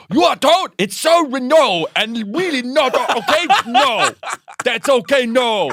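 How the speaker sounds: high, loud flustered scolding; mock angry dad. Dad too angry for English, high loud sputter.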